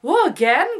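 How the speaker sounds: high, loud mock disbelief; incredulous again. Invited out again, answering in loud high disbelief. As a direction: squeak it out in disbelief. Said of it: "And I'm like".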